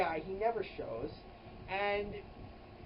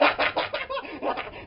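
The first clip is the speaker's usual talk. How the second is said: a forced, slightly evil cackle; a loud put-on laugh with a sinister edge; fake evil laugh; 7 semitones higher, 6 dB louder than usual. Shown as a demonstration. Crowd laughing at "I don't get it", forced evil laugh. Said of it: "it's kind of evil laughter".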